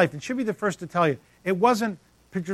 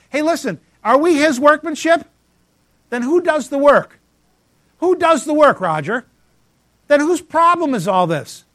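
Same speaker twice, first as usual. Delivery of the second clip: loud, high rising questions to the room; preacher booming questions at the crowd; preaching shout; 7 semitones higher, 7 dB louder than usual. Acted. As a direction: call out to the congregation, full force.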